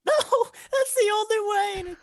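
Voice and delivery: very high, loud, slow wail; drawn-out high-pitched kobold voice; frightened kobold. Acted. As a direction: wail it like a cornered little creature. GM voices kobold answering questions.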